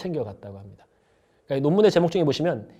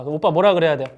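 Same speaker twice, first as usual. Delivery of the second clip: usual speaking voice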